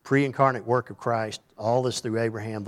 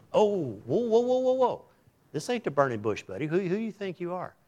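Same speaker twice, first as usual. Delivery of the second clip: high startled whoa, whoa; 8 semitones higher than usual. Retold or imitated. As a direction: play the outraged crowd.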